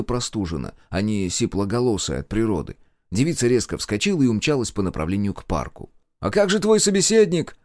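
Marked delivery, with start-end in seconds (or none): 6.2-7.6 high, raised-voice shout; calling after someone. Acted. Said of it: "крикнул он вслед". (громкий крик вслед)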